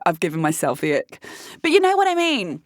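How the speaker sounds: ordinary delivery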